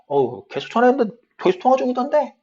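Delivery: high, quick, loud protest; protesting excuse. Acted. Friend excusing the missed call, high fast protest. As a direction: defend yourself in a hurried high voice. Acted reply in phone dialogue.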